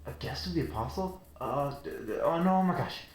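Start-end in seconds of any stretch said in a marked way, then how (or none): 1.4-3.0 panicked. Acted. Maid panics at her mistake.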